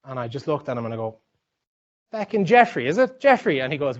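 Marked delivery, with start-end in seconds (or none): 2.1-3.6 much higher, louder, disbelieving exclamation; incredulous outburst. Retold or imitated. Spotting the same bracelet on a stranger, loud incredulous cry. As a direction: shout the name in amazed recognition. Recognising the bracelet seller's trick.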